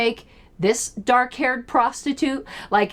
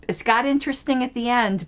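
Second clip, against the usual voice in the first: ordinary talk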